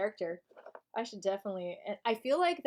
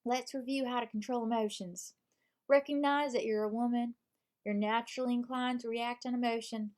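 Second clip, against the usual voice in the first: steady Southern read of self-help advice; Southern accent. Pretend. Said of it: "I'm reading this with a Southern accent".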